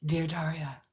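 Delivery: a light breathy phone-operator voice. Shown as a demonstration. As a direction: breathe the name out softly, teasing. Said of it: "a breathy voice".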